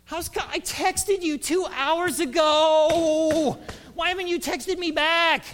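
high, loud mock-outraged whine; impatient complaint. Impatient friend demanding a text back, exaggerated outrage. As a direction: wail it, stretching the final words.

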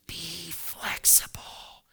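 slow whisper be flexible; hushed motto, almost a whisper. Shown as a demonstration. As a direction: breathe it out slowly, hushed. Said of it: "Say that with me".

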